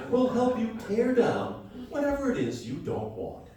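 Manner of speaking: usual speaking voice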